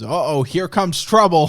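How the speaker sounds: high, loud cartoon sidekick shout; wisecracking bird. Bird friend warns the skaters, loud cartoon cry. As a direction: yell it like a cheeky cartoon bird. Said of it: "wisecracking bird friend looks down and goes".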